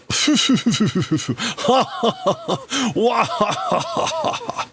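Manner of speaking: long high gleeful cackle; mad-scientist cackle. Acted. Scientist gloating over his plan, cackling high.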